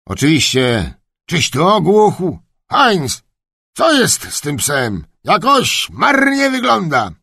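high-pitched scolding master, rising to a shout; irritable old duke. Acted. Duke questioning his kennelman about the dog, irritable. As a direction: scold the servant crossly.

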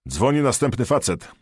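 called out loud and high; shouting. A narrator's reading. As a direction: shout it across the radio. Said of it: "krzyknął dyspozytor".

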